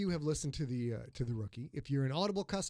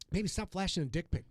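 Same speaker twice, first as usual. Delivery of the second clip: ordinary talk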